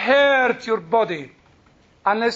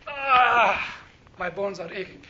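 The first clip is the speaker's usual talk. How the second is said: mock groan; 2 semitones lower, slower than usual. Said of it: "one of them said".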